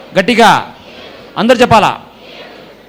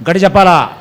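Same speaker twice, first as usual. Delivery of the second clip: the question pushed out louder to the crowd, with a falling end; louder call; 3 dB louder, faster than usual. Shown as a demonstration. Preacher urging the congregation to answer louder, raised voice.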